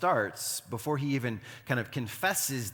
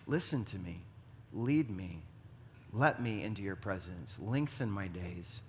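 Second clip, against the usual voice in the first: low, softened prayer; understated plea, slowing at the end; quiet cry to God; 4 semitones lower, 6 dB quieter than usual. Acted. Preacher summing up David's prayer to God, quiet pleading. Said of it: "So this is his cry".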